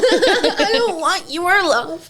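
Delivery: high, very slow melodramatic refusal; mock dramatic rejection. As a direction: ham up the heartbroken no. Friend playfully answers the quote.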